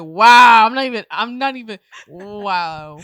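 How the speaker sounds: very loud, stunned wow; hammed-up loud disbelief; mock offense. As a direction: act floored and affronted. Retelling own offended reaction, very loud.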